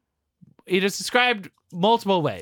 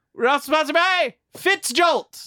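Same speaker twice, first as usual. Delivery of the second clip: announcer voice; 10 semitones higher than usual. Acted. Announcing a mock sponsor.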